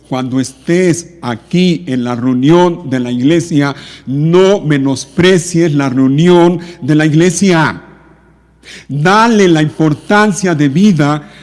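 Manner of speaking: loud pulpit voice with stretched stresses; forceful preaching. Real, not acted. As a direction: drive each phrase home to the congregation. Preacher exhorts the congregation forcefully.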